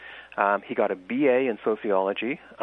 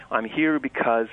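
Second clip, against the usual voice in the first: ordinary talk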